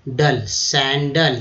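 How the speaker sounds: डल then सैंडल, slow and clear; syllable drill. Shown as a demonstration. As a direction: break सैंडल into its ending and rebuild it. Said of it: "और के रूप में बोला गया". (डल, सैंडल धीरे बोलें)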